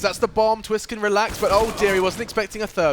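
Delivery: rising, loud live commentary; excited play-by-play. Real, not acted. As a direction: call the action as it unfolds.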